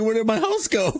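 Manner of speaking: high laughing villager voice; imitating villager. Gamer voicing the villager whose house he broke, high laughing. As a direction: voice the confused villager through laughter. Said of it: "This guy's like".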